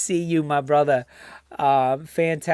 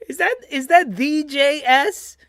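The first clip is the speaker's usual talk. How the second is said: excited; 8 semitones higher than usual. Real, not acted. Excited to find a friend.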